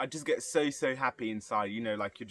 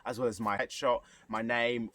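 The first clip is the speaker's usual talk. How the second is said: ordinary talk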